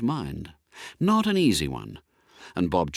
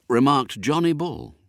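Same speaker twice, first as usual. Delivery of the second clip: usual speaking voice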